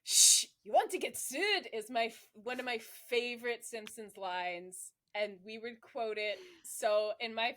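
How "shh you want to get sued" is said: put-on quick Scottish accent on a quoted line; a Scottish accent thrown on, half-hearted. Podcast host quoting a Simpsons line in a Scottish accent. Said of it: "It's the Scottish accent you just".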